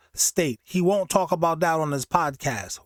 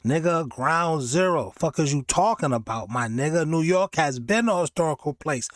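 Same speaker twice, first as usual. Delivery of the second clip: ordinary delivery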